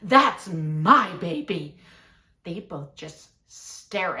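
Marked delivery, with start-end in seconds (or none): 0.0-1.8 loud, slow indignant claim; irate mother. Acted. Other mom claiming her child from a stranger, irate. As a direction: lean hard on each word, irate and loud.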